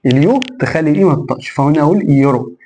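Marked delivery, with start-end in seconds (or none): none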